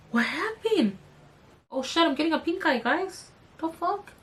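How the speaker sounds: soft, high, put-on panic; mock alarm. Mock panic over her reddening eye.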